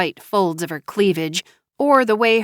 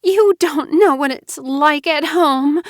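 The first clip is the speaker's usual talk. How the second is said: self-pitying Mara Joy; 8 semitones higher, 4 dB louder than usual. Acted. Wife explaining her pregnancy, high teary plea. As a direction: act wounded and scared.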